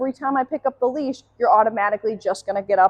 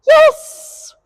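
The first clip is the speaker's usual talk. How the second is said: very high, loud bright yes; high marker yes; 10 dB louder than usual. Real, not acted. Trainer marking the dog's sit, high bright yes. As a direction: praise the dog with a sharp high yes. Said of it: "marked it with the word yes".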